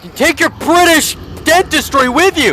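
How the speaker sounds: high loud mocking yell; taunting shout. Real, not acted. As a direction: jeer at the beaten foe. Taunting the defeated monster.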